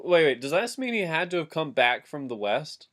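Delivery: ordinary delivery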